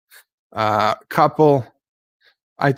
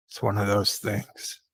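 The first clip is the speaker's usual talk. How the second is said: ordinary talk, nothing marked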